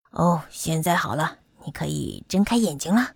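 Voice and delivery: low-pitched doctor character voice; kindly doctor. Acted. Doctor prepares to examine the bumblebee, low and kindly. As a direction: speak low, like an attentive doctor.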